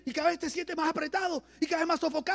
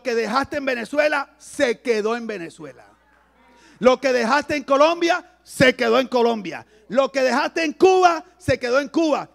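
emphatic preaching; 8 dB louder than usual. Acted. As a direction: drive each country home.

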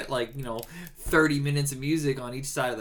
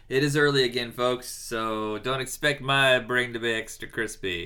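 ordinary talk

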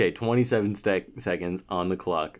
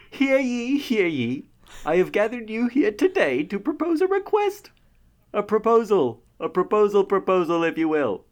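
town crier; 8 semitones higher than usual. Pretend. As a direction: proclaim like a royal herald. Opens roundtable as herald.

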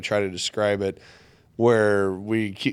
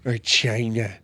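lightly voiced Trump saying China; a hint of the Trump drawl on China; mild Trump impression; 2 semitones higher, slower than usual. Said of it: "You gotta say it with that voice".

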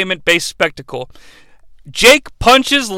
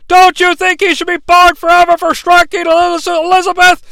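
commentator; 10 semitones higher, 3 dB louder than usual. Retold or imitated. Imitating commentator debate.

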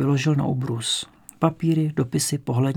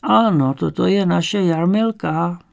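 put-on higher, slow old woman; old woman's voice; 4 semitones higher, 4 dB louder, slower than usual. A narrator's reading. Grandmother recognising granddaughter's photo, high slow old voice. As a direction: confirm slowly in an old woman's voice.

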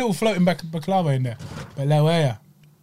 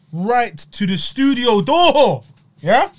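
excited announcement; 6 semitones higher, 4 dB louder, much slower than usual. Real, not acted. Food delivered, excited announcement.

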